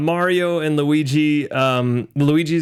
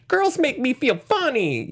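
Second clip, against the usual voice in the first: put-on higher, slow awkward boy; kid voice; 11 semitones higher, slower than usual. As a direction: play a smitten eleven-year-old. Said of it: "starting to be like".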